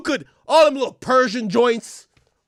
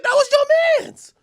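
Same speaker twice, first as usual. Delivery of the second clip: high mock plea; 3 dB louder than usual.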